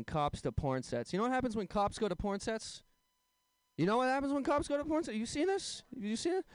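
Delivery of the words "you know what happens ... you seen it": high, worked-up questioning; mock incredulity. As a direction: pester the crowd in a raised pitch. Worked-up setup to the punchline.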